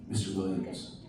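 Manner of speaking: low, soft, sultry-sounding hush; seductive doctor. Retold or imitated. Doctor asking the patient to undress, hushed and suggestive. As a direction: purr it like a come-on. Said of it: "At least that's how I heard it".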